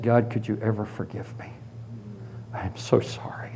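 soft, low, broken prayer of repentance; barely voiced, near tears; tearful plea. A weeping man begging God for forgiveness, soft and broken.

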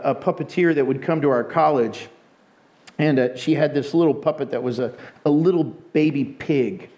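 usual speaking voice

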